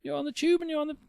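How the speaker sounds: fast, high, building rant; excited. Real, not acted. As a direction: rattle it off, pitch up. Excited rant about hating people.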